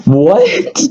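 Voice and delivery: squeaked high what in disbelief; a sudden, incredulous high yelp; shocked disbelief. Retold or imitated. Own shocked reaction to diagnosis.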